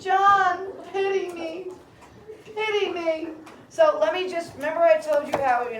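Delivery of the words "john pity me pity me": high-pitched pleading cry; desperate high plea, hammed up; pleading yell. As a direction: cry out high, begging him for pity. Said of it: "she yells out".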